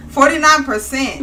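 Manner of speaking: hammed-up announcer punch; dramatic reveal. Shown as a demonstration. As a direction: punch forty-nine like a big reveal. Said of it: "Let's hear it".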